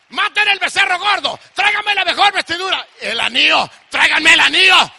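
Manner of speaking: loud, urgent commands; calling out orders. Acted. Father ordering a feast for his son, urgent commands.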